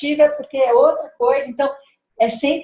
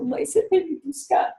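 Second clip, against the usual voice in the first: put-on higher, softer child asking to be picked up; daughter's small voice; 6 semitones higher, 4 dB quieter than usual. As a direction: voice a little girl on the phone. Daughter calling from a sleepover.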